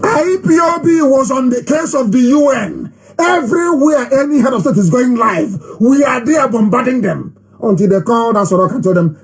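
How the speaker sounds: high-pitched, forceful proclamation; impassioned oratory. Acted. Activist boasts of campaign to followers, proclaiming. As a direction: proclaim it to a rally.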